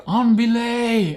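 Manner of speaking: high, slow called-out command; mock call. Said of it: "they're gonna say".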